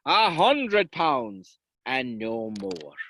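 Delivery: slow firm bargain. Acted. Sean naming his price.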